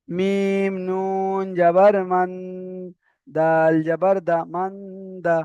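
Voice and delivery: slow reading demo. Shown as a demonstration. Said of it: "এরপরে পড়াটা দেখেন".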